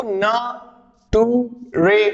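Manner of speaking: ना, टू, रे as separate chunks; syllable-by-syllable misreading. Shown as a demonstration. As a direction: read nature as NA, TU, RE with gaps. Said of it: "कैसे पढ़ रहे थे बच्चे". (ना, टू, रे अलग-अलग तोड़कर)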